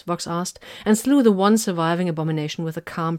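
usual speaking voice

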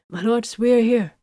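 a lightly voiced shuttle pilot. A narrator's reading. Pilot announces arrival.

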